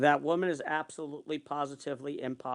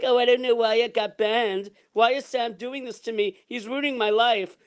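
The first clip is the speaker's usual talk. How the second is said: higher-pitched mock-innocent whine of complaint; playing innocent; 5 semitones higher, 10 dB louder than usual. Woman complaining about being banned, mock innocent. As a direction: imitate her acting the wronged innocent. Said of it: "acting the innocent one".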